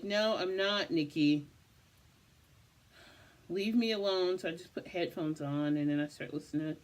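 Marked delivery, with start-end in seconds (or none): 0.0-4.4 irritated retort. Retold or imitated. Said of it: "I'm like".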